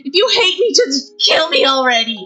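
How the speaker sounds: begging for death through sobs; girl screams and cries at her sister; sobbing scream. Acted. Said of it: "her screams".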